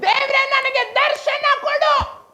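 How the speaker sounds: high, fervent plea; crying out to God. Acted. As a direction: plead upward, voice pitched very high. Models a cry to God.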